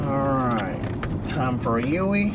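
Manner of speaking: talking over a bumpy ride; on a bumpy road. Real, not acted. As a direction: voice jolted by bumps. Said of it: "Sorry about the bumps".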